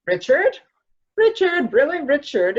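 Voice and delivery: calling Richard's name loudly into the dark. Acted. Wife calls out to Richard at night, anxious calls.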